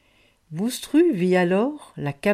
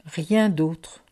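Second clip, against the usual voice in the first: ordinary delivery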